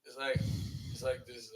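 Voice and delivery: usual speaking voice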